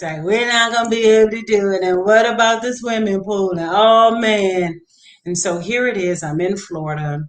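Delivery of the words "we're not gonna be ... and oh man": lightly voiced whiny kid, higher; a hint of a sulking little boy; mock-whining about the swimming pool. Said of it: "I'm marking the kids".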